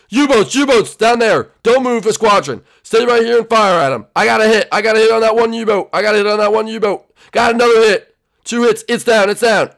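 loud, high, urgent combat calls; battle shouting. Acted. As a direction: shout orders and hits to the squad. Acted combat against sighted U-boats.

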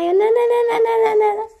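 very high, loud shrieked no; shrieking no. Pretend. A child protests in the game with shrill loud screams. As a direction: scream no over and over.

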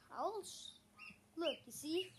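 normal talking voice

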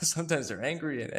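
a nervous laugh breaking into the words; laughing through it. Real, not acted. Father laughing at his clumsy war explanation, nervous laugh. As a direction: laugh helplessly at your own muddled explanation.

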